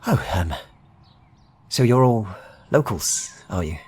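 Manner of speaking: friendly stranger nosing in on the locals; Midlander trader. Acted. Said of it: "simply states".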